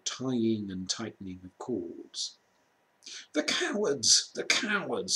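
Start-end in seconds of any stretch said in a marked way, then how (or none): 3.3-5.1 sobbed. A narrator's reading. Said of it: "sobbed Susan".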